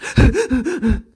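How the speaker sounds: loud, high, stuttering crying gasps; hysterical sobbing gasps. Retold or imitated. Woman acts out her breakdown on the phone, hysterical gasps. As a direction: imitate heaving, hysterical sobs.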